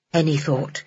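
plain reading voice

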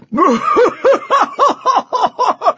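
booming roar of laughter. Acted. The champion mocks the small knight with a roar of laughter. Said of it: "burst into a big, beefy roar of laughter".

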